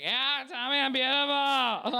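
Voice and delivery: high, slow, sloppy mock wail; hot-mess drunk. Woman making out outside a club, sloppy and needy. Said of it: "just such a fucking mess".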